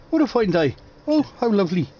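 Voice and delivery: hammed-up high Irish lilt; a jokey Irish accent impression, high and twee. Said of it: "who spoke in an Irish way".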